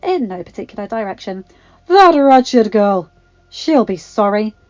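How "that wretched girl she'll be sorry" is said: put-on slow, loud old woman's grumble; indignant exclamation. A narrator's reading. Agatha shakes a tiny fist at Circe, indignant. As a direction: threaten loudly and harmlessly. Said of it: "she exclaimed before shaking a tiny and very unthreatening fist".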